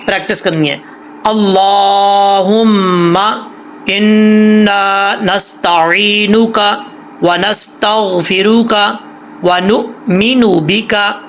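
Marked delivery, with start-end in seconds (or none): 1.3-11.2 slow, drawn-out recitation for students to repeat. Shown as a demonstration. Teacher leading students in practice, slow recitation. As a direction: chant each word long and clear. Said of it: "मेरे साथ साथ पढ़ना है".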